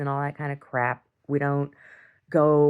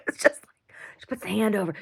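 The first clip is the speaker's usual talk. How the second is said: normal talking voice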